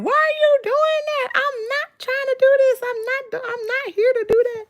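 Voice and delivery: high, squealed mock protest; exasperated mimicry. Mimicking the woman with the gun in the bank, high exasperated squeal.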